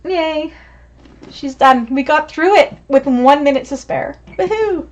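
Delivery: ordinary talk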